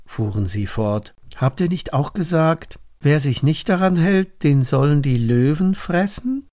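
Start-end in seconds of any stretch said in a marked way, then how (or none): 1.4-6.5 higher-pitched, pressing question; lightly voiced informers springing a trap; accusing courtiers. Princes remind king of the punishment, accusing.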